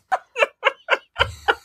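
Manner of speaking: high-pitched giggling laugh. Real, not acted. Laughs after her acted outburst.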